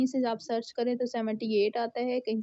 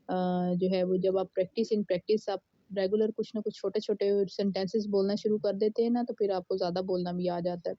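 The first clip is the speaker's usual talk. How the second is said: ordinary talk, nothing marked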